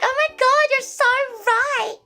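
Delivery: very high-pitched, over-eager imitation; mock gushing agreement. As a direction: gush in exaggerated agreement.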